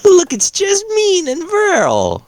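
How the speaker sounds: very high, slow, stretched-out squeal; hammed-up excited hail; excited calling out. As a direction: sing out the sighting in a high pitch. Player calls out on spotting friends.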